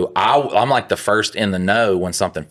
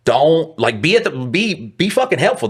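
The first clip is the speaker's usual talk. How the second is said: retelling yelling back at the shoving supervisor, heated; heated retort; 9 semitones higher than usual. Retold or imitated. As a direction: snap back at the boss, heated.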